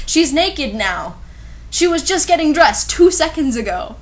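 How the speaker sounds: ordinary talk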